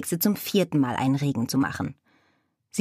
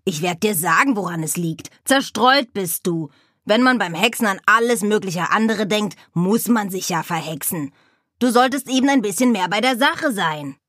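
lecturing raven; 3 semitones higher, 3 dB louder than usual. Acted. Raven Abraxas lecturing the witch, louder and higher. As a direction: lecture her like a know-it-all raven.

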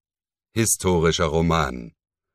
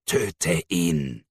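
softer hissing demon voice; demonic whisper; 4 dB quieter than usual. Acted. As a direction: hiss it like a demon tempting. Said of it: "zischte der Dämon". (dämonisches Zischen, leiser)

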